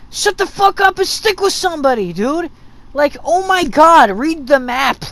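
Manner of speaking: loud, high-pitched angry outburst; fed-up gamer ranting back; exasperated scolding. Acted. Yells back at the panicking teammates.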